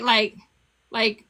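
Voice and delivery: ordinary talk, nothing marked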